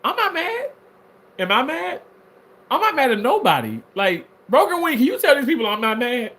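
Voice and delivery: high indignant denial; defensive denial. Real, not acted. Bro, you, you got mad.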